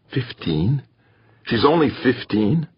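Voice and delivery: a touch of shock. A narrator's reading. Bracknell learns her age, stunned disbelief.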